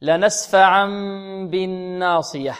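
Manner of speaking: recited verse; Quran recitation. Shown as a demonstration. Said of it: "لنسفعا هذه فعل ماذا؟".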